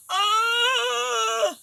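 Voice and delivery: high, loud cries of pain; loud pained groan. Acted. Crocodile wakes the forest with a loud groan of pain. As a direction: howl out in agony. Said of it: "he was crying real tears".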